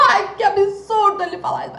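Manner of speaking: high laughing outrage at the memory; laughing disbelief. Real, not acted. (rindo indignada)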